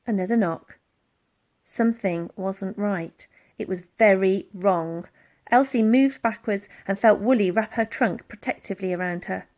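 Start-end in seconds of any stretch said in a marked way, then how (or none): none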